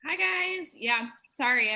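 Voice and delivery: talking with a mouth full of food. Real, not acted. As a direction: mouth full, still chewing. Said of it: "feeding my face right now".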